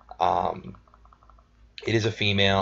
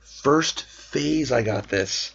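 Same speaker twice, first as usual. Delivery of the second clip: amazed; 5 semitones higher, much slower than usual. Real, not acted. Slow amazed statement.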